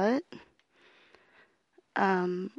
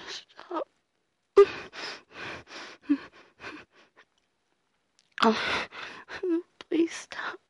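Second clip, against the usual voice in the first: crying plea; 3 semitones higher, slower than usual. Acted. Weak crying pleas.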